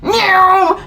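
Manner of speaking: high imitated engine whine; motorbike whoosh noise. Imitating the motorbike zooming past her car.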